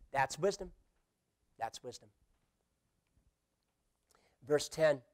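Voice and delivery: ordinary delivery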